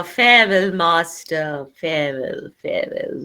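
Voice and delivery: from plain voice down to a whisper; fading farewell. Shown as a demonstration. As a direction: let each farewell fade away. Teacher acts Caliban's fading farewell.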